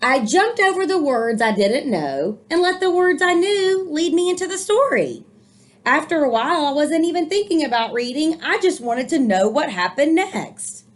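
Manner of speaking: put-on lively read-aloud for children; storybook reading. A narrator's reading. Teacher reading a picture book to young listeners, lively storytelling. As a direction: read the book warmly to the kids.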